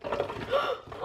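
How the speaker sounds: high startled gasp; sharp gasp. Real, not acted. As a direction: gasp in sudden alarm. Misses the blender.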